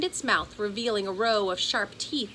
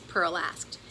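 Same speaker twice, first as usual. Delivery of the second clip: ordinary delivery, nothing marked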